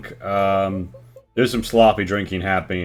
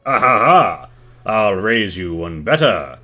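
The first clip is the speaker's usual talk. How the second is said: loud, hearty bellowing laugh and boast; booming barroom challenge; boisterous challenge; 6 dB louder than usual. Acted. Boisterous NPC challenges to drinking contest.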